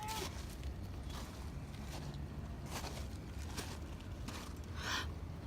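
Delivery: breathy shocked gasp; sharp gasp. Real, not acted. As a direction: gasp in sudden dismay. Finds hole in trampoline.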